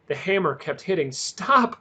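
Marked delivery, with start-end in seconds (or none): 1.1-1.8 gasping plea. A narrator's reading. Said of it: "Cole gasped".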